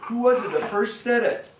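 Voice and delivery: ordinary delivery, nothing marked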